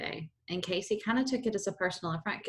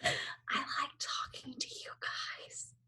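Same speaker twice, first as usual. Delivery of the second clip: whisper; 6 semitones higher, 10 dB quieter, much slower than usual. Retold or imitated. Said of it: "It was sweet because you're like".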